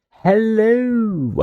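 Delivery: high, drawn-out posh hello. Real, not acted. Streamer greets everyone on coming back, suddenly posh. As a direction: greet them in a posh accent, voice lifted high. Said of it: "said it so posh there".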